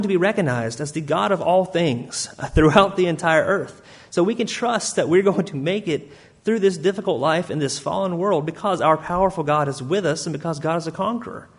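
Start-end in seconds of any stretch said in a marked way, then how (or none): none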